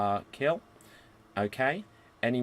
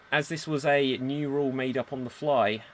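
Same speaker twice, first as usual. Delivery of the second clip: ordinary talk, nothing marked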